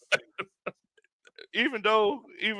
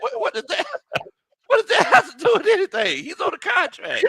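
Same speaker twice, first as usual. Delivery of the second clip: incredulous laughing. Retold or imitated. Recalling his reply to fans, high laughing disbelief. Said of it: "I'm like".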